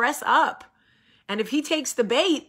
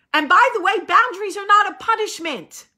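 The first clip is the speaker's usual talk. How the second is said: high, loud stress on not; firm declaration; 9 semitones higher, 5 dB louder than usual. Retold or imitated. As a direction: insist it hard. Declaring boundaries with emphasis.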